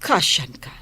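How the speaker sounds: hushed secret whisper; whispered aside. Acted. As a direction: breathe it so no one hears.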